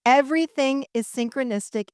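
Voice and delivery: ordinary talk, nothing marked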